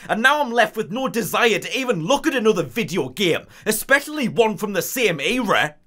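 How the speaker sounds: ordinary delivery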